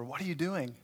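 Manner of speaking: usual speaking voice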